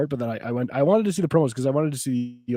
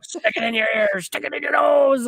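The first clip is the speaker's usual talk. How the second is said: wrestler taunt; 12 semitones higher, 3 dB louder than usual. Retold or imitated. Quoting Piper insult.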